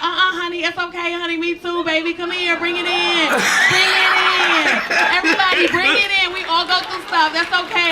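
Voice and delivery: high, loud, sassy comforting voice. Retold or imitated. Flight attendant comforting the crying passenger: high, loud, sassy. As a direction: call everyone in to hug, loud and camp.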